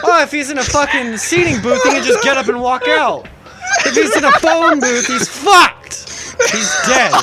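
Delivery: high, loud, laughing mock logic; laughing reasoning. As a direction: argue it through the giggles. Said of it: "I'm thinking".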